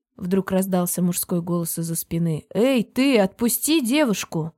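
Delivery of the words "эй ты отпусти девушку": calling out. A narrator's reading. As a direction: call out sharply to stop him. Neighbour calls out to stranger.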